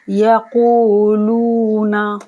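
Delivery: slow chanted word; slow recitation. Shown as a demonstration. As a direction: recite यक़ूलूना as flowing tilawat. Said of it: "दोनों को दो दो second के लिए खींचना है".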